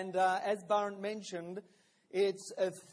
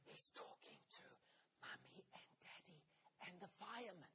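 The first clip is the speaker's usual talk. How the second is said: put-on voiceless, hushed child's answer; whispered; 3 semitones lower, 25 dB quieter, faster than usual. Child whispering down the phone to his father's boss. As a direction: keep it a secret whisper. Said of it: "Back came the whispered reply".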